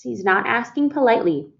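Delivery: ordinary delivery, nothing marked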